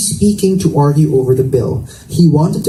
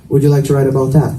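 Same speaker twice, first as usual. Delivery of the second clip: fast, direct and cool; Paul turning cold and arrogant toward Patrice; distant arrogance; faster than usual. A narrator's reading. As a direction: clip it out, aloof and direct. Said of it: "sounded distant once again, arrogant and direct".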